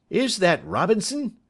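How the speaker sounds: high, loud shouted question; calling back. A narrator's reading. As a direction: call back into the dark. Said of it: "Tom Smith answered immediately".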